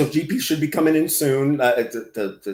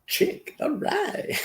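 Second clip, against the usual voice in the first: all right jumps very high and thin, soft; squeaky high all right; 8 dB quieter, much slower than usual. Shown as a demonstration. Reading chat names, answering in a squeaky high voice. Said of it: "So far, it's a bunch of chicks".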